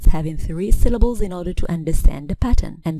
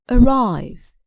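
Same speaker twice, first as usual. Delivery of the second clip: "arrive" stressed on its second syllable, higher pitched; 4 semitones higher than usual. Shown as a demonstration. Teacher showing verb stress to learners, "arrive" stressed on second syllable. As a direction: hit the end syllable of "arrive". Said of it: "Verbs stress the second syllable".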